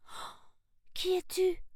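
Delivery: put-on high-pitched little girl's astonishment; a small gasp, then a high, amazed question; surprised. A narrator's reading. Sarah meets the creature in moonlight, high and surprised. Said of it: "murmura Sarah, surprise".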